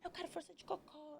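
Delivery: high, insistent mock midwife; lightly voiced scolding midwife; a hint of a pushy nurse; bossy midwife. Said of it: "Ela falou".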